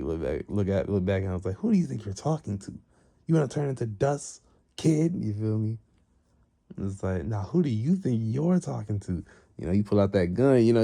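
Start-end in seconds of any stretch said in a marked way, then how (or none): 1.6-5.1 higher-pitched, mock-menacing challenge; indignant threat. Acted threat in a quarrel. 7.2-9.2 raised pitch, stress on "you"; indignant comeback. Retold or imitated. Rival throws the challenge back.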